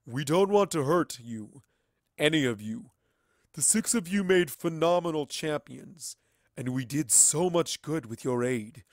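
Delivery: otherworldly entity. Acted. The entity explaining its plan to Twilight, loud and otherworldly. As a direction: speak like a being above mortals.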